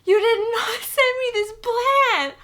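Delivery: giddy overwhelmed gasp; laughing disbelief. Real, not acted.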